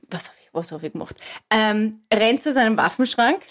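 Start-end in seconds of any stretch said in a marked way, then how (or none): 0.1-1.2 fast, panicked whisper. Acted. Man in the joke whispering in panic at the blood. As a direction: hiss it to yourself in horror. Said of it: "er denkt sich".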